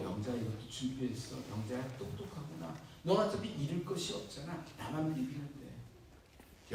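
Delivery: lawyer figure. Acted. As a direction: coax her quietly like a smooth lawyer. Lawyer persuades her. (낮고 느린 속삭이듯한 변호사 목소리)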